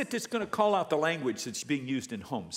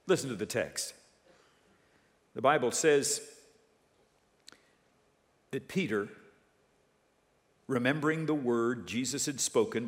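normal talking voice

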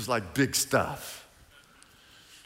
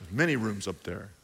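ordinary talk

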